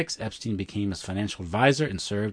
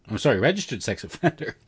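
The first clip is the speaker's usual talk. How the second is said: ordinary delivery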